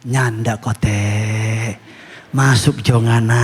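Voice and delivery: very slow, drawn-out Manado dialect voice of God; lightly voiced God speaking Manado dialect. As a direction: answer gently but firmly in Manado dialect, slowly. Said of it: "Tuhan bilang".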